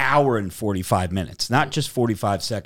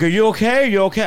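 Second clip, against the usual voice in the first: higher, louder mock concern; worried technicians; 8 semitones higher, 4 dB louder, much faster than usual. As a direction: call out like anxious technicians. Technicians over fainted man.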